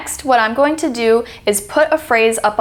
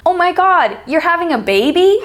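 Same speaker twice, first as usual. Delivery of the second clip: high, climbing excited exclamation; thrilled, with "baby" swooping upward; excited uphill intonation; 7 semitones higher, 3 dB louder than usual. Shown as a demonstration. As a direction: pitch rising uphill to a high finish on "baby?". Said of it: "See how it's uphill and it's excited?".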